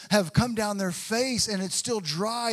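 ordinary talk

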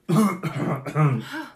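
coughing awake; acted choking cough on waking; spluttering cough. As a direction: cough and splutter as if coming round. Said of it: "kinda coughs and splutters awake".

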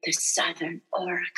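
imitating Bastian in a hushed whisper; a teasing, whispered impression of Bastian; mock whisper. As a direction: breathe it out like an awed kid, mockingly. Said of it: "And he would be like".

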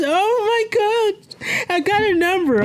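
thrilled, voice soaring; giddy excitement. Real, not acted. Exults over getting her number.

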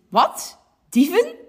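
Dieven leaps way up in disbelief; startled policeman crying out; alarmed question shooting high; shocked cry. Acted. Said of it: "roept agent Jan".

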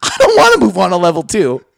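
loud, fed-up refusal; mock exasperated. Customer refusing the support agent, loud and fed up. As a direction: push back in exasperation. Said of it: "You're like".

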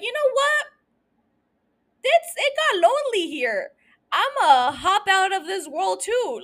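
high-pitched comic despair; mock giving up. Host imagines being the bereaved parent, mock giving up. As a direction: pretend to throw in the towel, voice way up.

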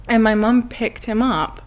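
usual speaking voice

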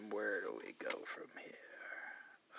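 slow breathy whisper to himself; hushed thinking aloud; whispered aside. Real, not acted. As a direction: murmur it under your breath, slow.

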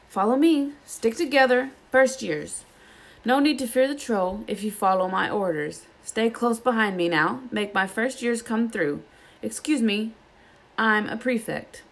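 herd the first years officiously; bossy prefect. Acted. Percy ordering first years back to the dormitories, pompous.

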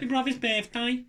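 high, slow mock Brummie; Brummie accent. Shown as a demonstration. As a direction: lay on a comic Birmingham accent. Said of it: "Birmingham has to be the worst one".

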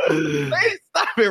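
slow, drawn-out mock plea; wailed pretend begging; imitated freak-out. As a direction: act out a kid losing it, stretching the words. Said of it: "just losing my shit".

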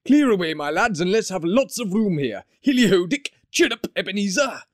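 high, hearty calls across the room; rally the lads cheerfully; merry old master calling. A narrator's reading. Fezziwig calling out merrily.

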